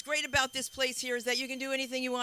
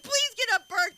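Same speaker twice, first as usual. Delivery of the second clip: much higher, louder begging; pleading; 10 dB louder, slower than usual. Retold or imitated. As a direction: beg the bird to get off. Pleading with bird on her.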